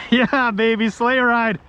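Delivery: high, exultant cheer; excited. Real, not acted. Fish pulling the boat along.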